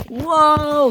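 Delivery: high, loud "whoa"; alarmed cry. A narrator's reading. Ferdinand flies down, alarmed cry. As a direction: whoop it in alarm.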